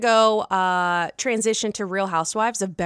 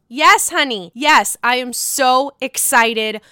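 excited announcement; 3 semitones higher, 5 dB louder than usual. Real, not acted. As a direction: shout the good news with joy. Celebrating big news.

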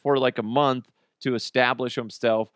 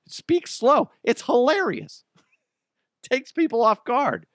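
excited high voice; 12 semitones higher than usual. Real, not acted. Excited urging listeners.

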